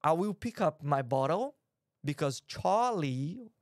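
British accent with long, drawn-out open vowels; slow English gentleman's accent, stretching Charlie and bar; simulated British accent. Shown as a demonstration. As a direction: put on a very British accent, dropping the R. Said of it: "Dá pra você simular accent?".

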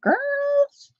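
calling out. Real, not acted. Crafter calling her viewers, high sing-song. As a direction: sing it out high to get their attention.

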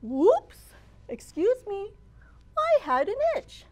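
high, surprised big-animal character voice; startled hippo apologizing. Acted. Hippo bumping into Mouse, surprised and apologetic.

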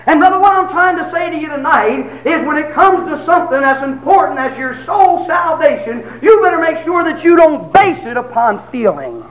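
preacher to congregation, full force; preaching at full force. Acted. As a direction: drive it home from the pulpit. Preaching climax.

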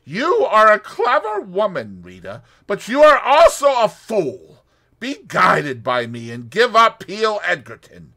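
loud, high agitated plea; Russian man urging excitedly. A narrator's reading. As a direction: press her hard, worked up. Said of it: "He was very excited".

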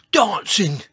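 loud, high incredulous echo; George. A narrator's reading. Dinosaur echoing the dancer in disbelief, loud retort. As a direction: throw the word back loudly. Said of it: "said George".

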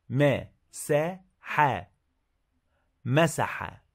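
slow, clear dictation of each word; dictating. Shown as a demonstration. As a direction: read each word slowly for a dictation test. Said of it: "It's a dictation activity".

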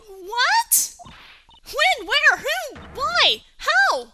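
high, loud volley of questions; shocked questioning. Acted. Sidekick hearing Edgeworth was arrested, shocked. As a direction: fire each word out high and loud.

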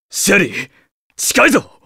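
alarmed cry to his partner; sharp call. Acted. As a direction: call out that the enemy is close. Sensing enemy nearby, calling out.

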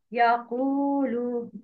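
يقول with a long drawn "uu", said slowly; long damma. Shown as a demonstration. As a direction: stretch the "uu" in يقول. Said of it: "Make long vowel ضمة". (slow model of the long damma)

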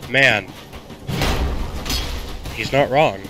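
ordinary talk, nothing marked

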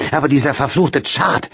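a sudden bitter burst; blurting out. Acted. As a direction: spit out the name in anger. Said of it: "platzte es aus Leonora heraus".

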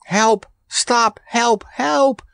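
high-pitched cries for help; screamed plea. A narrator's reading. Randy nipped on the ear by Mugly, screamed plea. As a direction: cry out high in short alarmed bursts. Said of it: "screamed Randy".

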